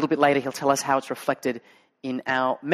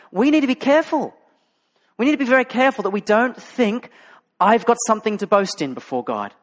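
raised, urgent pulpit delivery; preaching; 7 semitones higher, 5 dB louder than usual. Acted. Preacher urging his church to honour the weak, full force. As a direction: press the warning home to the church.

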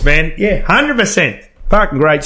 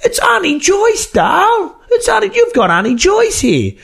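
put-on old auntie voice. Retelling Auntie Joyce answering the prank call, high shrill-free lady voice. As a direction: imitate the auntie squawking down the phone.

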